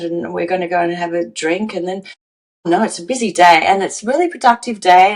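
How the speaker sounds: fast, rattled-off mock excuses; hurried busy person. Coach voicing someone too busy to feel, rushed. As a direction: rush through the schedule as if no time.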